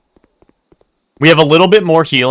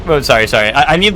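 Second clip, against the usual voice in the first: ordinary talk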